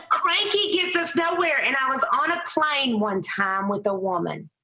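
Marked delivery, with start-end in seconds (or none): 0.0-1.6 high, laughing through the words. Real, not acted. Guest to host about staying cheerful, laughing high. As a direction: laugh it out, pitched way up. Laughing while giving advice.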